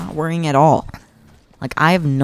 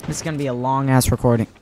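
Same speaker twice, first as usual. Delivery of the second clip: normal talking voice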